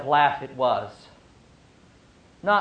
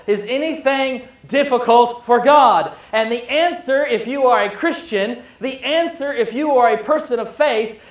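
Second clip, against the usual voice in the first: higher, rising fervent preaching; preaching at full force; 7 semitones higher, 4 dB louder than usual. Acted. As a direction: drive the question home to the congregation.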